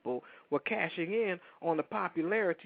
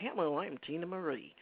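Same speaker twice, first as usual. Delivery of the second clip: prim proper greeting; 2 semitones higher, 4 dB quieter than usual. Retold or imitated. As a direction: say it politely and stiffly, as a caricature. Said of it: "she don't walk up to you like".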